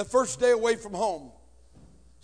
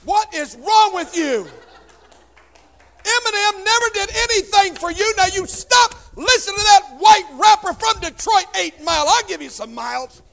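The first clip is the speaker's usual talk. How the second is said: much higher, louder fiery scold; scolding shout; 12 semitones higher, 13 dB louder than usual. Acted. Comedian scolds the church audience, fiery shout. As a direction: blast the crowd like an outraged preacher.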